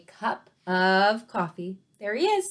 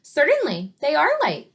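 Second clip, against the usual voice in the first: loud, higher-pitched storybook retort; 8 semitones higher, 6 dB louder than usual. A narrator's reading. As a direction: fire it back emphatically. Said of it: "retorted Mister Tappleton".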